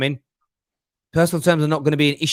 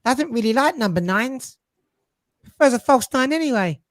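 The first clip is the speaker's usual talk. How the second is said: quoting Pep's critics; 11 semitones higher than usual. Retold or imitated. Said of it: "heard this been said about Pep".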